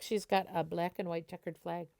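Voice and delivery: plain reading voice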